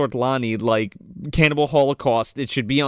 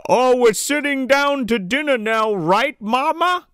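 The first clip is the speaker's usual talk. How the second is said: Santa through a megaphone; 8 semitones higher than usual. Retold or imitated. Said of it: "megaphone and he's like".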